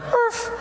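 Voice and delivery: tiny high, breath-light "urf"; imitated dog bark. Dog reacting to a jogging neighbor, small high bark. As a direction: give a little squeaky dog yip. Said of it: "My dog just went".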